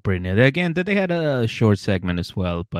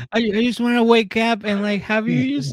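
put-on much higher, a babyish pleading voice; childish whine. Mocking a grown man asking to be babied, high childish whine. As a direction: whine like a kid who wants tucking in. Mocks being babied by partner.

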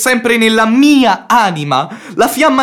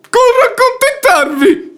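high, laughing through the words with a catch of tears; a mocking laugh hiding hurt; bitter laugh; 11 semitones higher than usual. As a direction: laugh it off bitterly, close to crying. Spiteful reply in lovers' quarrel.

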